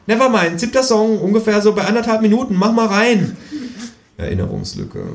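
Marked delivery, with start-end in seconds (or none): 0.1-3.3 high cartoon kangaroo instructions; high-pitched know-it-all kangaroo; bossy kangaroo. A narrator's reading. Kangaroo figure.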